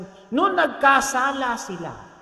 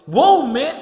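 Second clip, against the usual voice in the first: a loud, higher burst of amazement; startled wow; 4 semitones higher, 8 dB louder than usual. Acted. Adam startled by Eve, loud surprised wow. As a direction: blurt it out, caught off guard. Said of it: "Nagulat siya".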